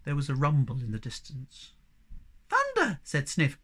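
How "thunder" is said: very high, piping cry; alarmed squeak. A narrator's reading. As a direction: squeak out in fright. Sniff frightened by thunder.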